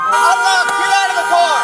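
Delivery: loud commands; shouting. Real, not acted. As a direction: bark the order loudly. Police ordering driver out.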